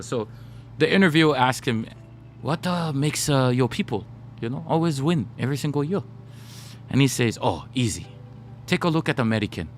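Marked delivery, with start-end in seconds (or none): 2.4-6.0 imitated Japanese accent, halting delivery. Interviewer asking the coach why they always win, imitated Japanese accent. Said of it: "I'll do my Japanese accent". 7.4-9.7 amateur imitation of a Japanese accent. Champion coach answering the interviewer, put-on Japanese accent. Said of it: "I'll do my Japanese accent".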